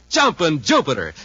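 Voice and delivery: usual speaking voice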